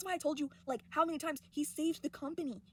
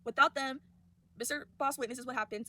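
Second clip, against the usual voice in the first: ordinary delivery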